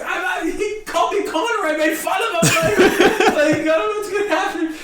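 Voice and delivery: high panicked laughing shout; freaking out. Retold or imitated. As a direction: blurt it out in a nervous panic. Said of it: "I'm freaking out".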